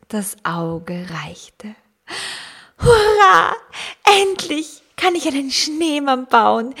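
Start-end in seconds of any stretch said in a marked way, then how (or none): 2.8-6.7 high, loud joyful shout; excited cheer. Acted. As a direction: cheer it out like a thrilled child. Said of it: "rief Marie begeistert". (begeistert jubelnd)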